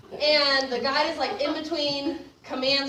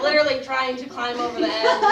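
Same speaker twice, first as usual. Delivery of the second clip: usual speaking voice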